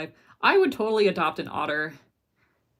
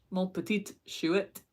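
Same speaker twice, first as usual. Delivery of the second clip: slow, lightly put-on French accent; French-sounding; 3 dB quieter, slower than usual. Trying out a viewer's French phrase, French-sounding. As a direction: say it slowly with a hint of French. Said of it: "It sounds French".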